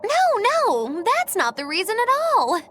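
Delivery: high, loud theatrical protest; exaggerated denial. Accused of being peckish herself, high hammed-up denial. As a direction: deny it far too much, pitched right up.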